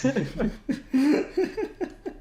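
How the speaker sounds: high, bright real laugh bursting out; burst of laughter. Real, not acted. Co-host laughs at the dog-king joke, a high real laugh.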